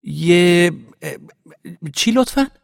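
high, loud, halting disbelief; stumble, then call out the question; startled question. A narrator's reading. Parvaneh shocked by the reporter's claim, startled loud question.

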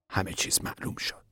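breathy hushed close; whisper. A narrator's reading. Floyd finishing his warning to Tom, whispered. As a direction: let it fall to a whisper.

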